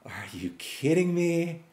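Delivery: exasperated outburst. Retold or imitated. Driver whose car won't start, loud exasperated disbelief. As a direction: imitate your own fed-up outburst at the car. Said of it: "And you're like".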